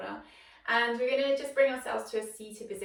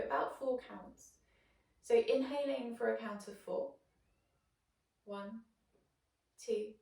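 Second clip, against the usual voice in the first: guided meditation; 4 dB quieter than usual. Acted. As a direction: guide the breath quietly, leaving space after each phrase. Leads a breathing meditation.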